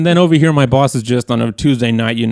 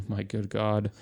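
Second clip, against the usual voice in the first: much softer, sinking sigh of defeat; resigned groan; 11 dB quieter than usual. Retold or imitated. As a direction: let it fall away quietly. Resigned at forced choice.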